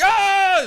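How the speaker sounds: loud, high drill-instructor scream; wordless full-force yell; retold boot-camp scream. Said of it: "everybody's yelling and screaming".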